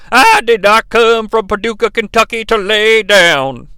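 imitated southern preacher voice. Imitating the new commentator from Paducah, southern preacher voice. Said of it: "like, a southern preacher voice".

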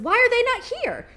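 high, wondering ask; puzzled question. Retold or imitated. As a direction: let the puzzle lift the voice. Voicing the author's question.

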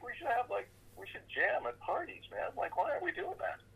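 eager. Retold or imitated. Pitching jam idea to bandmates.

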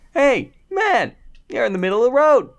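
high, loud calling out; mock farmer holler. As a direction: holler it high and fast, hammed-up. Imitating farmer calling from truck.

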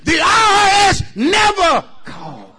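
high, full-voiced preacher's shout; emphatic warning. Real, not acted. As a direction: holler it out, stretching the key words. Repeats warning after demanding a hearing.

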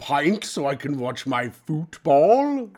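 lightly hammed British accent; a bit of fake British charm; mock British football guy. Ordering a pint in a mock British accent. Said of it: "What is this accent?".

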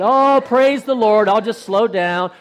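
slow, drawn-out hammed-up praise; mock sweet piety. Teasing Jeff about patient driving, in mock sweet piety. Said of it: "I know how good Jeff is about that".

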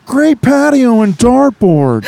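high, slow, drawn-out laid-back surfer voice; Owen Wilson. Pretend. Host reads a dive bar review as a high, slow Owen Wilson. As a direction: stretch every word like a stoner dude. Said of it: "Owen Wilson".

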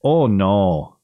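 stretched Midwestern "oh"; Midwestern long O. Retold or imitated. Host demonstrating how Midwesterners say their ohs, mock Midwestern accent. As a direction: imitate Midwestern round, drawn-out ohs. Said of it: "they say their ohs weird".

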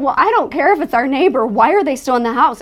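retold panicked retort. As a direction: throw it back loudly at a husband, as retold.

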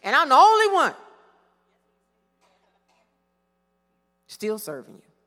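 Elijah's aggrieved whine, swelling loud on only; heartfelt complaining spiel. Retold or imitated. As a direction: plead your case to God, aggrieved. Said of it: "long, heartfelt spiel".

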